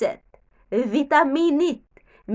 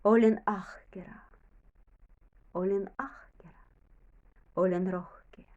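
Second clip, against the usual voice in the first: soft, breathy whisper telling yourself you are brave; gentle whispered self-talk, softer than the lesson voice; whispered affirmation; 6 semitones lower, 7 dB quieter than usual. Shown as a demonstration. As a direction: whisper it like a secret promise to yourself. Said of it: "Sanot itselle".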